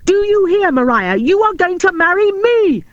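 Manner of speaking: high, loud, demanding; shouting. A narrator's reading. Robin shouting his proposal at Maria. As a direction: hammer home the order. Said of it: "he shouted".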